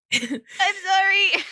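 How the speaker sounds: high, slow apology through real laughter; laughing. Real, not acted. Apologizing to listeners while laughing.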